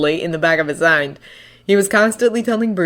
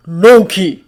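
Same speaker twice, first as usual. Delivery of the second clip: angry name through gritted teeth; 6 dB louder than usual. A narrator's reading. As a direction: press the name out in anger. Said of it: "pressed out through his teeth".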